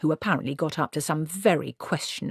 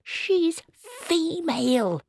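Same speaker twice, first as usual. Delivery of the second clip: much higher and slow, owlish outrage; hooted; 13 semitones higher, much slower than usual. A narrator's reading. Granny hooting at the tree about the girl. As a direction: hoot it in indignation. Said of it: "hooted Granny".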